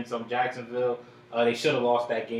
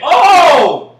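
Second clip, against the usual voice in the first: excited shout; 24 dB louder than usual. Real, not acted. Reacting to a long run.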